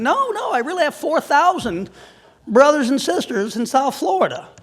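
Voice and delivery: insisting. Retold or imitated. Telling a puzzled stranger about his four thousand brothers, loud insistence. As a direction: insist it's true, voice up high. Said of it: "I mean".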